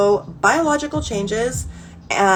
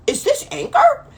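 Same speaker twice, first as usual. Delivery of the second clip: very high, put-on squeaky question; mock bewilderment; 3 dB louder than usual. Woman mocking her late discovery of anger, squeaky bewilderment.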